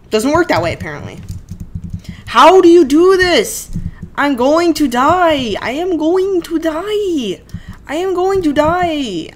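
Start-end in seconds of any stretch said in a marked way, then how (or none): 2.3-9.3 dramatic panic. Real, not acted. Panicking in the game.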